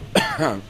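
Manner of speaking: sudden cough from a swallowed bug; just swallowed a bug. Real, not acted. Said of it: "I think I swallowed a bug".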